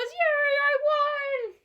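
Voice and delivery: high, loud triumphant squeal; gleeful cheer. A narrator's reading. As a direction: cheer like you just won the prize. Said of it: "he goes".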